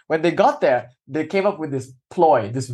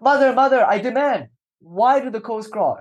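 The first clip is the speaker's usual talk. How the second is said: put-on high, insistent child's voice; the boy runs back and insists to his mother, high; demanding child; 8 semitones higher than usual. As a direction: demand it like a stubborn little boy.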